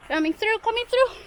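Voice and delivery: high, loud repeated call; coming through shout. Real, not acted. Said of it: "Letting wildlife know I'm here".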